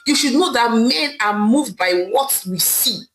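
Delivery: usual speaking voice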